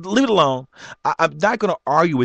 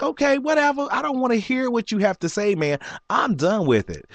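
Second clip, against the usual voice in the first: pitched way up, waving him off; high dismissive brush-off; 10 semitones higher than usual. Retold or imitated. To the manager who keeps berating him, a high dismissive brush-off. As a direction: done with it, high and fast.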